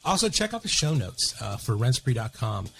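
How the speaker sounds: soft, even sponsor read. Acted. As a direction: read the sponsor spot smoothly. Sponsor ad read over music.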